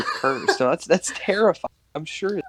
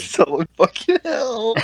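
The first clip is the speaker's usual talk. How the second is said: laughing imitation; 8 semitones higher than usual. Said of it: "just imagine the woman screaming".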